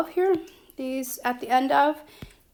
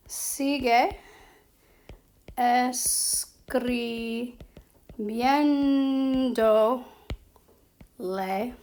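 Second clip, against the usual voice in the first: extremely drawn-out syllables, long pauses; slow dictation; much slower than usual. Shown as a demonstration. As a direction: sound it out very slowly while writing. Writing out the second version.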